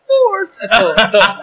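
high, strained dying Bubba voice; Bubba from Forrest Gump. Retold or imitated. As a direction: do Bubba gasping after he's shot. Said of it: "That's the one impression I can do".

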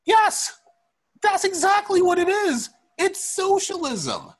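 high, loud exasperated yes; emphatic exclamation. Real, not acted. Host answering co-host's question about socialism, loud emphatic exclamation. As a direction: cry it out high, then draw out the last word.

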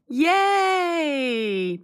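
high, drawn-out cheer; delighted cheer. Real, not acted. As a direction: let out a long, high yay of delight. Cheering a new friendship.

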